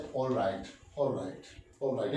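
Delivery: drawn-out, soft "all right, all right"; teacher demoing agreement to the class, slowly; slow repeated all right. Shown as a demonstration. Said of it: "या बोलते हैं".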